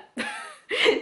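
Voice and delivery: short high giggle at herself; high-pitched little laugh; embarrassed laugh. Real, not acted. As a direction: giggle at your own muddle. Lost while pointing at swatch.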